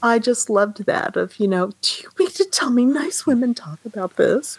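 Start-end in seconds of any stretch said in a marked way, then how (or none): 1.8-4.6 shocked, hushed whisper; scandalised whisper. Retold or imitated. Quoting Mrs Mead's scandalised line.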